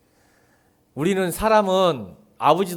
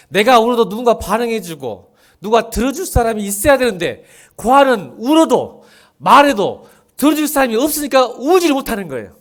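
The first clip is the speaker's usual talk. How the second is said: higher, louder impassioned sermon voice; fervent preaching; 6 semitones higher, 9 dB louder than usual. Acted. Pastor pleading with the congregation, full-force preaching. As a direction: preach it out with rising fervor.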